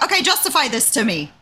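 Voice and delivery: high, insistent retold demand; exasperated challenge. Manager questioning two developers over costly monitors, exasperated. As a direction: make them justify themselves.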